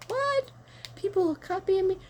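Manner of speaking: high squeaky mock disbelief; mock amazement. Retelling her reaction to the kids, high squeaky amazement. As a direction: gasp it out like you can't believe it. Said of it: "I'm like".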